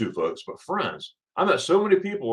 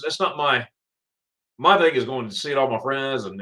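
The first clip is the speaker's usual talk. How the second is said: usual speaking voice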